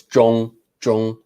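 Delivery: Jong said with a level first tone. Shown as a demonstration. Teacher modelling Jong in first tone for the student. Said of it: "And first tone".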